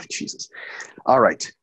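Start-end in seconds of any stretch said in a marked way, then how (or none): 0.1-0.5 breathy whispered Jesus. Real, not acted. Host reacts to torn Achilles joke, whispering. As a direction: whisper it under your breath.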